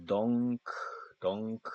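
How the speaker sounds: dunk 아주 천천히 늘여서; dunk를 한 음씩 끊어서; slow sounding out. Shown as a demonstration. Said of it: "D에다가 O에다가 옹크". (천천히 끊어 읽는 dunk)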